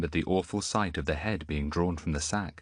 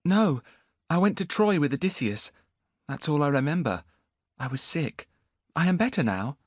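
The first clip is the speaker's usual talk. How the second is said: put-on young boy; 8 semitones higher than usual. Boy to Antiochus, high bewildered voice. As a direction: sound lost and bewildered.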